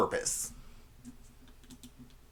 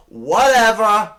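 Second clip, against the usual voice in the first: higher, louder dismissive whatever; catchphrase "whatever"; 8 semitones higher, 9 dB louder, slower than usual. Shown as a demonstration. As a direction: toss out a big sassy whatever. Demonstrating a nineties catchphrase.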